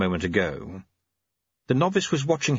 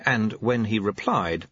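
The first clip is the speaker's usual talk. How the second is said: usual speaking voice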